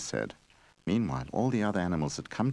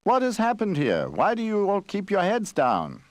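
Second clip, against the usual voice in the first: booming, high, drawn-out hello to the tree; loud bear greeting; 13 semitones higher than usual. Acted. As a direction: call out loudly, unaware of the hush. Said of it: "the bear had spoken very loud".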